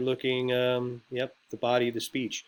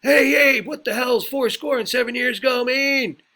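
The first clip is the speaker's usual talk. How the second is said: very high, loud yelled question; heckler's shout; 12 semitones higher, 10 dB louder than usual. Acted. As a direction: shout up at the speaker from the crowd. Heckler interrupts the Lincoln speech.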